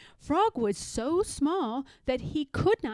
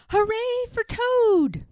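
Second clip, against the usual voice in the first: high, loud, slow cheer; shouted cheer; 11 semitones higher, 6 dB louder than usual. Acted. Frog cheers Toad from the theater, high loud shout. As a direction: cheer him on at full voice. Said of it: "as he shouted".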